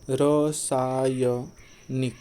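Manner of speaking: रसायनिक drawn out very slowly; slow dictation. Shown as a demonstration. Teacher slowly writing रसायनिक on the board. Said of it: "यह शुद्ध है कि अशुद्ध है".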